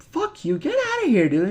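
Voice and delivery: high, mocking voice telling you off; a hammed-up brush-off from the Brotherhood; mock Brotherhood dismissal. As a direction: sneer "get outta here" up high. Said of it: "the Brotherhood was like".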